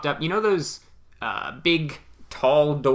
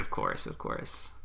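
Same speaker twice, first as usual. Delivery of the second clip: sinister whisper; 10 dB quieter, slower than usual. Pretend. Playing along with brainwashing joke.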